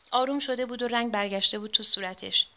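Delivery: ordinary delivery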